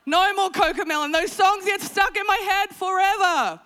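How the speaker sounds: high, loud comic rant; fed-up parent. Acted. Mother banning Cocomelon, loud and exasperated. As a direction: escalate into a pleading shout.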